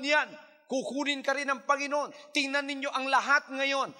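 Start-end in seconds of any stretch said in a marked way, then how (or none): none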